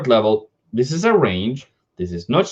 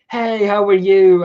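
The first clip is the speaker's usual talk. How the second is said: higher, louder, drawn-out greeting; cheery hello; 7 semitones higher, 4 dB louder, slower than usual. Retold or imitated. Acting out greeting an old friend coming back, cheery and slow. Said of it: "I will be the first one to be".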